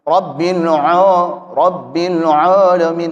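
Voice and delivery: "Rabbil alamin" slow, the "a" rounded to "o"; wrong thick ain. Shown as a demonstration. Teacher shows the mistake, heavy rounded "alamin". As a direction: thicken "alamin" into "olomin", drawn out.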